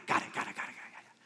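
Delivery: quick repeated got it, whispered away to nothing; fading mumble. Acted. Waiter hurrying off from the customer, fading unvoiced mumble. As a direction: brush the customer off as you walk away, voice gone.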